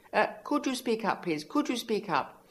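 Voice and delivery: very high, loud snooty complaint; hoity-toity, annoying. A narrator's reading. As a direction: call out sourly like an uppity director. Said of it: "said the annoying lemon-up-the-ass woman".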